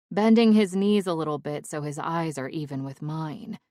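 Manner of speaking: plain reading voice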